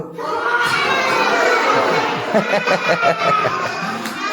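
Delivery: put-on high squealing horse neigh by a child; whinny like a horse, high and long. Child whinnying like a horse for the teacher. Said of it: "कैसे आवाज निकालते?".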